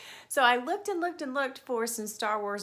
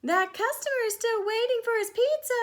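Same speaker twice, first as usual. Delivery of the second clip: much higher and louder nagging reminder; wife's urgent voice; 12 semitones higher, 6 dB louder than usual. Acted. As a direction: hurry him along like Tony's wife. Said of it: "said Tony's wife".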